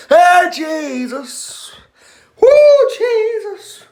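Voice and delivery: slow, drawn-out, very high cry for help; stretched-out high-pitched whoop of distress; high wail. Real, not acted. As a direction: wail to heaven in a squealing falsetto. Crying to Jesus over burning mouth.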